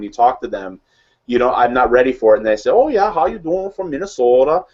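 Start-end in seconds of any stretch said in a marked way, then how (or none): 2.7-4.6 put-on high, singsong Minnesota accent. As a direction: imitate a friendly Minnesotan, long rounded O's. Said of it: "friends who are in Minnesota".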